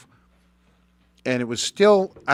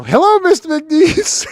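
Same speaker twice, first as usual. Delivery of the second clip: much higher and louder, slow and overly polite; mock formal greeting; 10 dB louder, slower than usual. Man meets his old coach years later, slow mock-polite greeting. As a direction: greet him like an obedient little boy.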